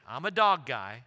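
ordinary delivery

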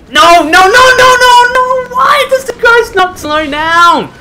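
very high, loud desperate protest; worked-up yelling. Real, not acted. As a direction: wail the repeated no at full volume. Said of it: "I gotta calm the fuck down".

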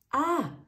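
high loud frightened scream; startled scream. A narrator's reading. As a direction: scream in shock. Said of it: "schreit der Chefkoch".

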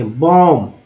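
बॉम्ब as "bom", ending on m; silent B. Shown as a demonstration. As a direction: say बॉम्ब without any b at the end. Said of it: "बी को नहीं पढ़ेंगे".

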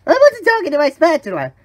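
high, fast, loud protest; panicked denial. Acted. Worker caught by his boss, frantically denying.

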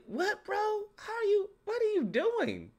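baffled, rising questions up high; incredulous reaction. Retold or imitated. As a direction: squeak it out in mock disbelief. Acting out reaction to scrawny Triton.

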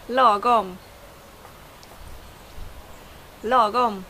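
lagom with a long first vowel; Swedish lagom. Shown as a demonstration. Teacher introducing a word only in Swedish, lagom pronounced. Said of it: "a word that I think only is in Swedish".

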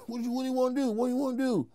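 high, hammed-up impression of a nervous tough guy; mock Cat voice. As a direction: pitch jumped way up, imitating a jittery little guy. Mimicking Cat confronting him.

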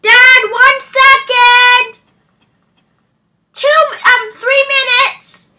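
loud drawn-out call across the house; yelling back to dad from the game. Real, not acted. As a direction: shout it out to dad in another room, stretching the words. Calling out to dad downstairs.

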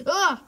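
short, loud cry of alarm; startled yelp. Real, not acted. Player running for their life, startled yelp. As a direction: cry out sharply in fright.

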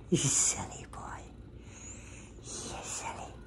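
soft whispered pet names. Real, not acted. Owner whispering pet names to her cat, whisper.

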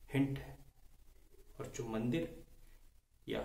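ordinary talk

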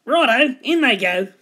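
eager Dingo. A narrator's reading. Dingo adding creepy crawlies, eager. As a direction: give a hearty go-ahead.

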